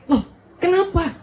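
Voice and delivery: high-pitched, startled questioning; mock-surprised, pitched well up; acted surprise. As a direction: play the startled sweetheart getting an unexpected gift. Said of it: "pacarnya kaget".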